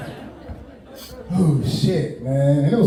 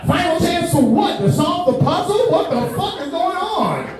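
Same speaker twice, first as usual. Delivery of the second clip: incredulous disbelief; 9 semitones higher than usual. Retold or imitated. Incredulous rhetorical outburst.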